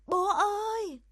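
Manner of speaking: high, loud scream for Dad; a child's panicked shout; frightened scream. A narrator's reading. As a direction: cry out in terror from a nightmare. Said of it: "Bống sợ hãi hét lên".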